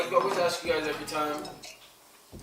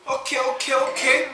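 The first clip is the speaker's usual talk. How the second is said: loud rising okay, okay, okay; excited okays; 10 semitones higher, 8 dB louder than usual. Real, not acted. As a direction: burst out with okays. Reacting to spicy food.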